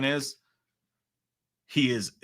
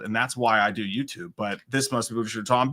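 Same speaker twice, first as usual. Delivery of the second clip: usual speaking voice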